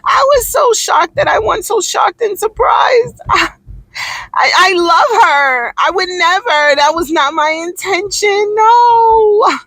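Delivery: high, gushing fake-surprised voice; high fake-innocent Taylor impression; mocking Taylor. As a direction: play Taylor faking shock, high. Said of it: "This will be Taylor".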